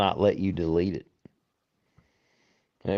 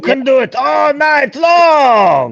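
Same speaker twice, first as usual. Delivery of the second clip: long drawn-out cheering shout; hype shout; 10 dB louder, slower than usual. Real, not acted. Cheering his buddy on with a slow big shout. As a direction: cheer him on, stretching every word. Hyping a friend for tonight.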